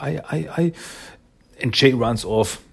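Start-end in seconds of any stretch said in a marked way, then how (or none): 0.0-0.7 high, panicked stammer; mock panicked stutter; acted panic. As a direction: stammer as if too panicked to finish. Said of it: "totally in panic".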